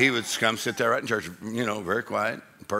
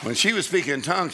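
ordinary talk

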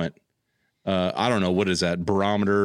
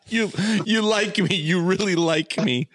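mock acceptance speech; 6 semitones higher than usual. Quoting Oscar acceptance speech.

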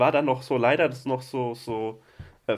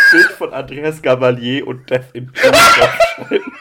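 laughing; 13 dB louder than usual. Real, not acted. Joking meme idea, laughing.

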